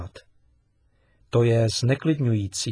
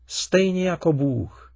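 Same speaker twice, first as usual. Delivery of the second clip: put-on a boy's eager exclamation, pitched up; excited exclaim; 7 semitones higher, 3 dB louder than usual. A narrator's reading. Boy cries out to his father, excited. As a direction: blurt it out like a sudden discovery. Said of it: "vykřikl Milan".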